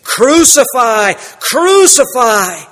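loud, slow, high Crucify chant; crowd's cry. A narrator's reading. Preacher voicing the leaders' swift reaction, loud slow shout. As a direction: shout it like a baying mob. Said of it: "Their reaction came very swiftly".

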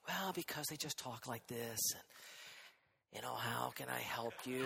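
hushed, low show of false humility; very soft talk. Acted. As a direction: talk very softly, as if meekly offering help. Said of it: "Sometimes people talk very softly".

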